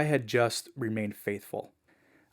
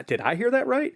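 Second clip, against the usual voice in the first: much higher, incredulous check; disbelieving question; 13 semitones higher, 3 dB louder than usual. Retold or imitated. Teller questioning what the family just said, incredulous. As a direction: ask like you can't trust your ears.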